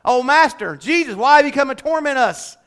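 a touch of anguished appeal; retold cry. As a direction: cry out in mild protest, retelling it. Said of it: "he cried to Jesus first".

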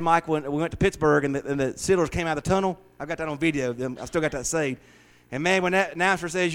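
ordinary talk

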